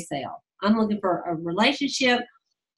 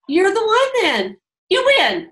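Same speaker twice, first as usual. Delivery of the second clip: excited; 9 semitones higher, 5 dB louder, slower than usual. Retold or imitated. Excited pick at party.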